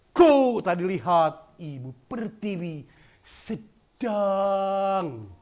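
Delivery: "Ku" pressed loud, "sedang" drawn out slowly; pressed and slowed. Shown as a demonstration. Teacher showing how to press and slow the line. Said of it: "ditekan keras".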